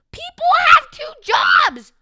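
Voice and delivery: mocking high shout, stretched out; hammed-up screaming caricature of a politician; Alexandria Ocasio-Cortez. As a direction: blare each word loudly in ridicule. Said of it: "according to Alexandria Ocasio-Cortez".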